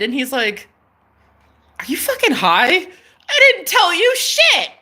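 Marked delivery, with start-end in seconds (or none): 1.8-4.7 high, laughing imitation of his disbelief; retold incredulity. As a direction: mimic his baffled denial, climbing high through laughter. Retold baffled crush on phone.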